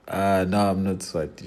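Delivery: ordinary talk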